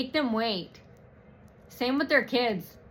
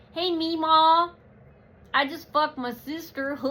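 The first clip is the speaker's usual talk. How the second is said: mock inbred hillbilly; 6 semitones higher than usual. Vlogger mocking backwoods folk with an inbred yokel voice. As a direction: play a dim yokel calling out to Meemaw. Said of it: "a bunch of inbreds live in the woods".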